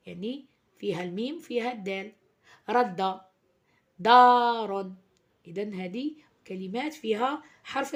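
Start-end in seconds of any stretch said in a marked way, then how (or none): 2.7-3.2 رد said loudly and carefully; loud teacher's model reading of رد; clear model word. Shown as a demonstration. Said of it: "مثلًا كما كلمة". 4.0-4.9 دار said much louder, carefully drawn out; loud, drawn-out teacher's model reading of دار; clear model word. Shown as a demonstration. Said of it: "مثلًا كما كلمة".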